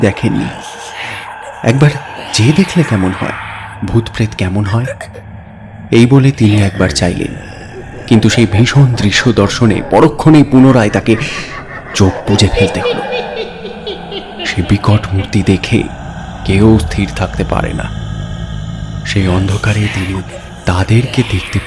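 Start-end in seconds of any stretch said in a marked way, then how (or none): none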